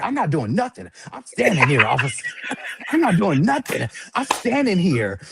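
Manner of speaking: high, laughing through the protest. Retold or imitated. Man pleading innocence to police, high laughing protest. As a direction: insist you did nothing while cracking up.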